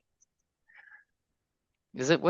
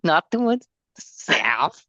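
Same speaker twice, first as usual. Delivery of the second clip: higher, slow Borat-style imitation; Borat accent; 6 semitones higher, slower than usual. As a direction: imitate Borat, drawing out the words. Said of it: "say the south like Borat".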